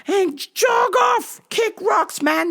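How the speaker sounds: high-pitched mock-angry send-off; exasperated dismissal. Store worker telling a flirting customer to leave, exasperated.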